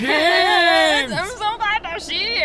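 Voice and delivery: big put-on voice, gushing with relief; overblown, stretched-out greeting; Doctor Brewer voice. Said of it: "What does he sound like?".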